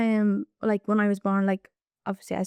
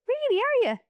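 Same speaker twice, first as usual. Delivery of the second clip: put-on high playful surprise to a child; mock surprise. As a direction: act amazed for the little girl. Child says she's visually impaired.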